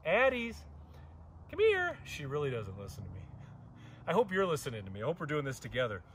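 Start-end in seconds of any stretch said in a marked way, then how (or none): 0.0-2.0 calling out. Real, not acted. Owner calling wandering Addie back, high-pitched call.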